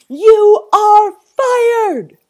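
very slow, high, thunderous bellow. Acted. Zoo owner sacking zookeeper, bellowing. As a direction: stretch each word into a roar. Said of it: "bellowed Sir Basil".

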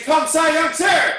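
loud high barked command; shouted command. Real, not acted. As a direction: call it out sharply. Martial arts class command.

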